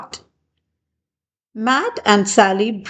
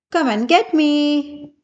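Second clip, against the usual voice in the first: teasing; 6 semitones higher than usual. A narrator's reading. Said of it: "teased Tanner".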